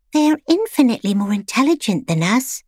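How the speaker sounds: hint of an awed little pet; lightly voiced timid pet. A narrator's reading.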